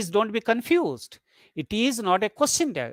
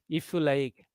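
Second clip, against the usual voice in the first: lower, softer "if you like"; trailing IF YOU LIKE, low and soft; afterthought; 5 semitones lower, 5 dB quieter than usual. Shown as a demonstration. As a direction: drop "if you like" down and quieter, tacked on. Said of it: "said as an afterthought".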